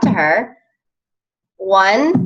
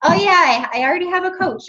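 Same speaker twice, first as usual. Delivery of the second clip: high, breezy dismissive reply; mock prospect brush-off; 10 semitones higher than usual. Coach mimics prospects brushing her off, breezy mock. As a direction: toss it off like it's old news. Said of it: "How many times do we get".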